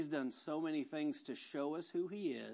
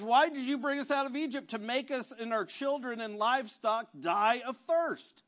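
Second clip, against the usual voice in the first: raised, higher voice of a grumbling crowd; quarrelsome complaint; 8 semitones higher, 6 dB louder than usual. Retold or imitated. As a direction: complain loudly at Moses. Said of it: "They're quarreling with Moses".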